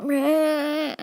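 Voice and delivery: high, drawn-out mock dinosaur screech; long raptor whine, hammed-up; velociraptor noise. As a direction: imitate an angry kid screeching like a velociraptor. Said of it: "making some velociraptor noises".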